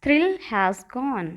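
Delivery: very slow, each word stretched; slow drill. Shown as a demonstration. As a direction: say it slowly so students can repeat. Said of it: "कहिए".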